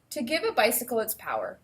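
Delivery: normal talking voice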